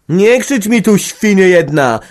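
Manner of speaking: gniewny, głośny wrzask; wrzaśnij z wściekłością. A narrator's reading. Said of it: "wrzasnął głos z sieni wywartej". (organiścina wrzeszczy gniewnie na Magdę)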